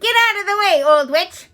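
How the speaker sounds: high, loud rude brush-off; a boy yelling at a witch. A narrator's reading. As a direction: shove past with a yell. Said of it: "Eric R yelled".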